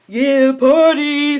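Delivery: loud, high, drawn-out party whoop; mock party holler; hyped party guy. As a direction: imitate a reveler yelling over the music. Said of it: "He's like".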